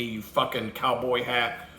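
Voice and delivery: ordinary delivery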